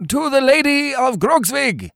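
very high cheering cry, little extra volume; shouted group toast. A narrator's reading. Twenty-four Lincoln Greens answer the Baron, high shouted toast. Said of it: "shouted the Lincoln Greens".

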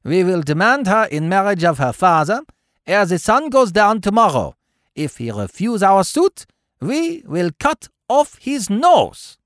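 high-pitched proclamation, slowing for the final threat; declaiming Baron. A narrator's reading. Baron rouses his men to demand a bride, high declamation.

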